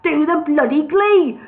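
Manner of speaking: bully them into drinking; boss rant. Acted. Brewery boss hectoring his sober workers, loud.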